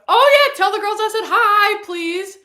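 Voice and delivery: high, loud, cheery shout; excited calling out. Real, not acted. Streamer sends greetings to the girls, excited and loud. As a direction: call it out as an eager afterthought. Calls out a sudden message.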